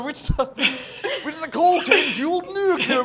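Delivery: high, fast, through laughter; laughing. Real, not acted. Narrator explaining the pun, laughing. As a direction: giggle the explanation out.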